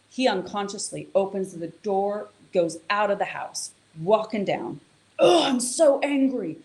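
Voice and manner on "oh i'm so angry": mock angry groan. Man's inner voice after a row.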